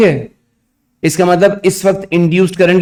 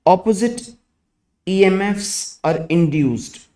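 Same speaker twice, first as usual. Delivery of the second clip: slow, word by word dictation; dictating; much slower than usual. Acted. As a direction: dictate slowly for students to write. Dictation continues.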